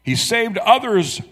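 high, slow sneer; scoffing. Acted. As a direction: scoff like a smug priest. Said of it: "they scoffed".

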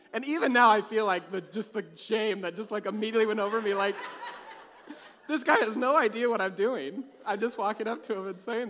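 telling it while cracking up; laughing and loud. Real, not acted. Still laughing at the memory.

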